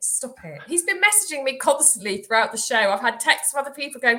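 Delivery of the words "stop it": softer, lower repeat of stop it; soft embarrassed plea again. Real, not acted. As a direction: beg quietly. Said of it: "oh, don't".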